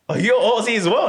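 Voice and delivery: high, loud delighted surprise; retelling the shock of meeting another Aussie; surprised question. Retold or imitated. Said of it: "I was like".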